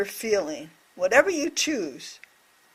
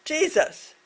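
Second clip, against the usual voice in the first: mock shout; 7 semitones higher than usual. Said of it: "He shouted out".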